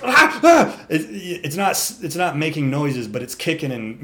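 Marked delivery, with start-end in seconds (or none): 0.0-0.8 high loud mock whinny cries; horse squeal. Imitating the tangled horse's noise, high loud cries. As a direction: shriek like a bucking horse. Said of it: "but it's just".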